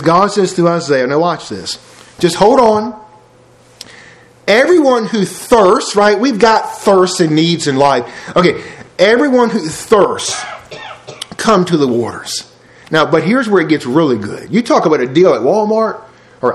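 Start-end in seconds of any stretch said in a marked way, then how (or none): 4.5-6.0 slow, weighty reading of each word; slow scripture reading. Shown as a demonstration. Preacher reads Isaiah to congregation, slow and weighty. 9.0-12.4 slow measured read, stress on Everyone; slow scripture reading. Shown as a demonstration. Preacher repeats Isaiah's call, slow and measured.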